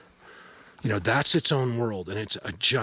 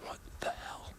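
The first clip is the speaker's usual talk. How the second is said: whispered; 18 dB quieter than usual. Real, not acted. Startled whisper to himself.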